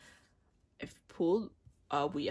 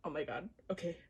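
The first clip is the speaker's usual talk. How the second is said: ordinary talk, nothing marked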